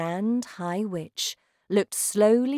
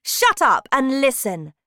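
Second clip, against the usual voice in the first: shouting witch; 8 semitones higher, 9 dB louder than usual. Acted. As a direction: bellow like a terrifying witch queen. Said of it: "shouted the Grand High Witch".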